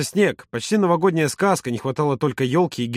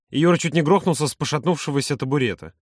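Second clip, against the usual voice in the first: ordinary delivery, nothing marked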